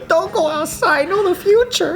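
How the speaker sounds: put-on very high laughing baby voice. Voicing baby again, laughing.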